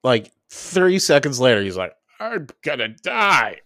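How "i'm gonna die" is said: very slow, drawn-out dying voice; mock panic. First-time smoker to his friend, slow mock panic. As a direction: play the stoned panic slowly. High guy panicking after a drag.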